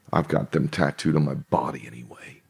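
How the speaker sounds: a confiding whispered aside. Real, not acted. Aside muttered under breath.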